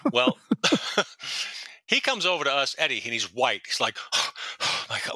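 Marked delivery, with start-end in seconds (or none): 4.1-5.2 slow, voiceless gasping words; mock hyperventilating. Eddie reeling from the prank call, imitated hyperventilating. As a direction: imitate panting in shock. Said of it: "like, hyperventilating".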